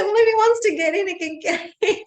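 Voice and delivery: high-pitched, laughing through the words; real giggles breaking into speech; laughing while talking. Real, not acted. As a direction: barely get it out for laughing.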